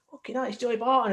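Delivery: high-pitched mock astonishment; high, starry-eyed quote; retold amazed exclamation. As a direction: retell a mate's star-struck shock. Mate spots a famous player.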